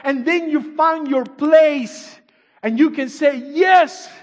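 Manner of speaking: loud, slow, high preaching cry; slow loud pulpit cry that peaks on Yes; preaching shout. Acted. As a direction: build the call up to a shout.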